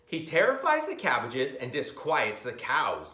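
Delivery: usual speaking voice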